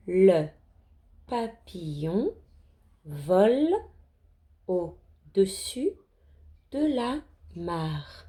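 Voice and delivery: slow, deliberate word-by-word reading; lecture mot à mot. Shown as a demonstration. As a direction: read slowly, pausing after each word or group. Teacher models reading word by word. (lecture lente, mot par mot)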